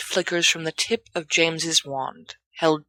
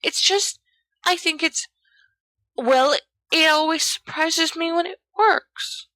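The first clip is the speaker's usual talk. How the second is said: put-on much higher, hesitant boy's voice; nervous boy admitting surprise; muttering Peter; 12 semitones higher than usual. A narrator's reading. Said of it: "Peter mutters".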